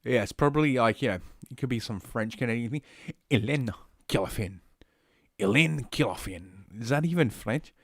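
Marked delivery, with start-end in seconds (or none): 3.3-4.6 French accent attempt. Shown as a demonstration. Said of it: "How the hell can I not do a". 5.4-6.8 low, slow stab at a French accent; slow French accent attempt. Shown as a demonstration. Streamer trying out a French accent on the name. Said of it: "that doesn't even sound French to me".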